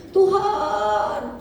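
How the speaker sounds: a mild retold cry to God; a touch of a desperate call. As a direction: call out to God, half-voiced. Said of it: "saya teriak".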